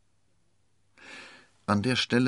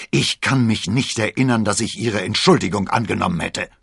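loud, indignant reprimand; thundering; 4 semitones higher, 8 dB louder than usual. A narrator's reading. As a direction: thunder angrily at the man who bumped you. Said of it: "donnerte der Musketier". (laut donnernd, empört)

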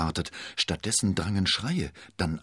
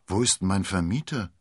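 plain reading voice

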